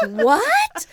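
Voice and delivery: stunned, laughing shock; astonished what. Retold or imitated. Stunned by mom's confession.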